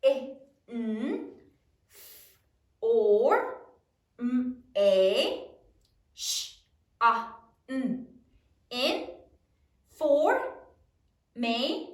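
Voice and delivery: E, n, f, or as isolated sounds with pauses; each sound alone, then syllables "In, for, may". Shown as a demonstration. Teacher models spelling sounds for class, sounding out. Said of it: "Sound it out".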